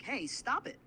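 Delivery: mock protest at being tickled; acted ticklish protest. Assistant imagining being tickled, playful protest. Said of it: "maybe say something like".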